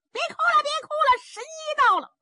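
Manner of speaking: very high, loud yell; hollering. A narrator's reading. Zhao Bi shouts to the weeping men while walking, hollering high. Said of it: "赵壁一边走一边嚷".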